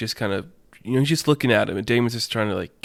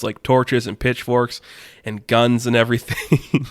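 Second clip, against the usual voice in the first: usual speaking voice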